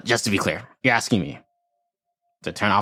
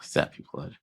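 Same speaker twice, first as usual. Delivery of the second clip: whispered, softer, savoring it; whispered echo; 8 dB quieter than usual. Acted. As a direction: breathe the words back in a whisper. Player echoes it back under breath.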